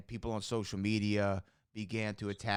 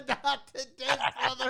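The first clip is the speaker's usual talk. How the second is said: much higher mocking mom voice. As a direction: squeak it like the mother.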